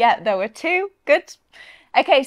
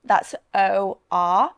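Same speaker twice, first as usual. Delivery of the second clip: ordinary talk, nothing marked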